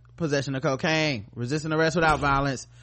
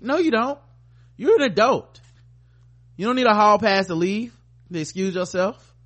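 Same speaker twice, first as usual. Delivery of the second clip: louder, higher, pressing the point; emphatic scolding; 5 semitones higher, 4 dB louder than usual. Real, not acted. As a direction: tell them off firmly. Scolding imagined churchgoer.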